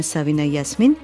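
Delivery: ordinary delivery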